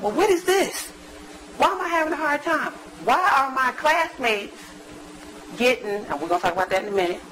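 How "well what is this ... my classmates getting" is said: high, puzzled voice of her younger self; a touch of baffled frustration, pitched up; bewildered younger self. Retold or imitated. Recalling her confused early career, mildly bewildered. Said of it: "I was like".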